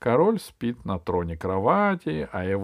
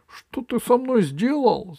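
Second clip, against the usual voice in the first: high, raised outraged question; indignant cry; 14 semitones higher, 5 dB louder than usual. Acted. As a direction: cry out as the wronged king. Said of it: "закричал король". (возмущённый крик)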